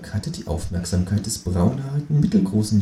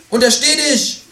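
much higher, indignant kangaroo cry; kangaroo shouting; 4 dB louder than usual. Acted. Kangaroo bursts back in to stop the narrator, high shout. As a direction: stop him, outraged. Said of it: "rief das Känguru".

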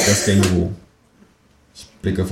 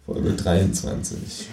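usual speaking voice

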